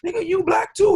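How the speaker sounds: very high put-on voice; mock customer. Customer retorting to the clerk in very high mock voice. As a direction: squeak the comeback way up high. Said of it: "And he was like".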